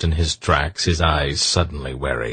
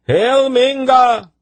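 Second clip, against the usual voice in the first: very high, loud, drawn-out hail; responding shout; 6 dB louder, slower than usual. A narrator's reading. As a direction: shout it back like saluting guards. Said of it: "the other guardsmen responded".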